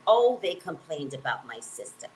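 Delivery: ordinary talk, nothing marked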